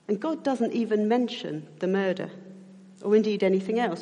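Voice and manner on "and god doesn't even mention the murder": ordinary delivery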